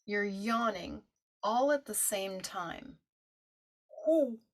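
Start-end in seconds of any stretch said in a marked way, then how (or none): 4.0-4.4 high, loud falling owl hoot. Shown as a demonstration. Teacher showing the class the hoo, owl hoot. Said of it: "you're hooting like an owl".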